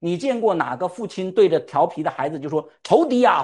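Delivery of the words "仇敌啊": mock outcry. Father scolding child as enemy, mocked.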